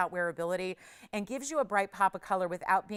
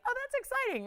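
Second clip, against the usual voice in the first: very high sarcastic gush; high, put-on delight, tongue in cheek; mock excitement. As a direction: fake being thrilled by a tiny win. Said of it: "I was like".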